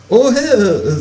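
high startled kangaroo voice; surprised kangaroo. Acted. Kangaroo finds the door already open, high and surprised. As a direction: jump up high, taken by surprise. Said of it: "sagt das Känguru überrascht".

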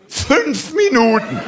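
mock out of breath. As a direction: play it exhausted and panting. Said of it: "hab ich die Lunge ausgekotzt".

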